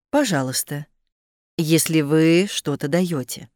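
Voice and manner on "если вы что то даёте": ordinary talk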